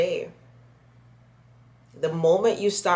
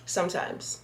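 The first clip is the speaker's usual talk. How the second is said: Sometimes set apart, whispered and softer; stress on sometimes; 3 semitones higher, 5 dB quieter than usual. Shown as a demonstration. Admitting to viewers she's only sometimes chatty, stress on Sometimes. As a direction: lean on Sometimes in a breathy hush. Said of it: "Emphasis on the sometimes".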